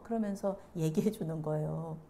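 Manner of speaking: normal talking voice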